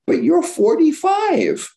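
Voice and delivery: mock astonished comeback; incredulous retort. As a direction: say it as if it's absurd. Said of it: "And I would say".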